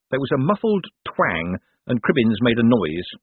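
plain reading voice

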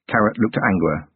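ordinary delivery

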